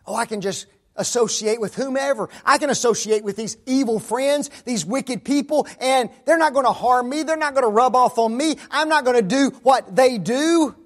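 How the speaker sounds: put-on high, loud boastful young voice; mock cocky youth. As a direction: play a kid sure nothing can touch him. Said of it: "how about this last one".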